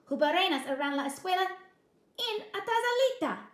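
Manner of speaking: loud, high chihuahua voice; declaring. A narrator's reading. Chihuahua describing the bully, loud declaring. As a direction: declare the danger. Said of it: "declared Don Diego".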